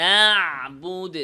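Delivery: নাবুদ slow and loud with a firm আইন; clear ain. Shown as a demonstration. Teacher drilling the class, নাবুদ with a clear আইন. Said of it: "আইনটা যেন মোটা আলিফ না হয়ে যায়".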